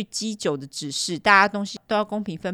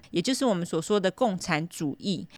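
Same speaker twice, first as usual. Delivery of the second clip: ordinary delivery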